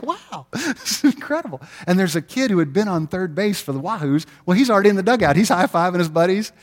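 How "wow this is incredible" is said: put-on amazed kid. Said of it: "He was like".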